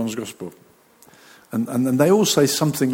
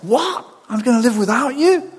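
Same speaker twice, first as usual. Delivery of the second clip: much higher, louder, a disbelieving laugh; stunned and half-laughing Peter; shocked Peter; 9 semitones higher, 5 dB louder than usual. Retold or imitated. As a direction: Peter can't believe what he hears. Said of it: "He's like".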